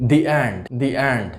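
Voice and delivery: "the end" slowly as "di end", long ee in "the". Shown as a demonstration. Teacher slowly demonstrates di before a vowel. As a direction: stretch "di end" so learners hear the ee. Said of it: "সেক্ষেত্রে উচ্চারণ হবে দি".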